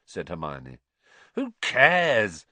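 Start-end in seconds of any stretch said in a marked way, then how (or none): 1.3-2.5 loud, high, dismissive; impatient. A narrator's reading. Ron waving away the worry, impatiently. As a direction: brush it off loudly and impatiently. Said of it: "said Ron impatiently".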